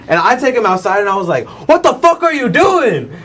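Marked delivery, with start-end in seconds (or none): 1.7-3.1 high-pitched mock yell without full volume; retold screaming. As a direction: re-enact an outraged outburst, voice shooting up. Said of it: "I started screaming at this dude".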